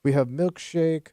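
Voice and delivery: slow mock Russian accent; broken English, heavy Russian accent. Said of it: "Stop doing the Russian accent".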